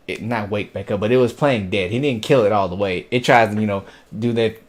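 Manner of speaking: ordinary delivery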